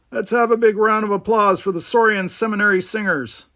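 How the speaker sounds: high, loud show announcer; stage announcer. A narrator's reading. Announcer asks the audience for applause, projecting. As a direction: announce it to a whole hall. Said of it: "the announcer said".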